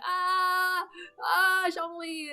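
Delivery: high, drawn-out squeal at normal volume; held-back reenacted scream; mock screaming. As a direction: wail the name slowly, pitched high. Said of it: "I'm still screaming".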